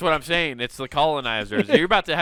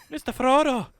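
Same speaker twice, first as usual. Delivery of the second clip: high, lilting hobbit voice; an exaggerated Shire lilt; 9 semitones higher than usual. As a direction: chirp it like an eager hobbit. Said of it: "sounding like Pippin or Merry".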